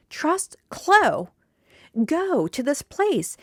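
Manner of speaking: "Trust Clo" high, then "Go to this place" slow; sentence reading. Shown as a demonstration. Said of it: "that's a sentence I'm saying".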